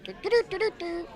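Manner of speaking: very high squeaky robot voice; Eddie. Acted. Naming his Mega Man roles, squeaky high Eddie voice. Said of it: "I was also Eddie".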